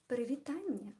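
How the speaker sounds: Привітання said in native Belarusian sounds; Belarusian greeting. Real, not acted. Greeting viewers in her native tongue, Belarusian hello. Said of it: "I said hello in Belarusian".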